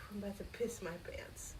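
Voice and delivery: soft whisper, frightened; scared whisper. Real, not acted. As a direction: whisper it so the spider won't hear. Scared, hushed confession.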